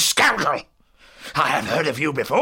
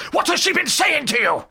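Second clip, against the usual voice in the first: high, shouted interrogation; loud angry outburst, pitched way up; furious demand; 11 semitones higher, 9 dB louder than usual. Acted. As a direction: shout it as an enraged old man. Villain demands answer.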